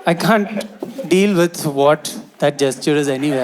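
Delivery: usual speaking voice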